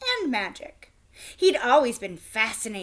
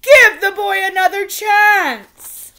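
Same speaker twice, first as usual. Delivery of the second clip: much higher, louder, slow desperate plea; king begging; 8 semitones higher, 14 dB louder, slower than usual. A narrator's reading. King begging Sir Bedwetter to give Harry another chance, high and loud. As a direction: plead as if your last knight is walking out. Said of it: "the king begged".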